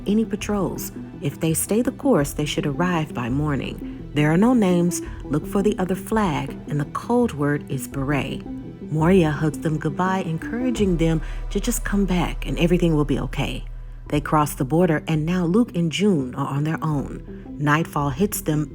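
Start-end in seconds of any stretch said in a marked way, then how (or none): none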